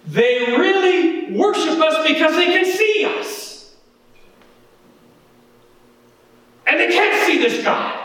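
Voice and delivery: high, emphatic preacher's delivery; urgent pulpit exhortation; impassioned preaching. Real, not acted. Presses climax of warning.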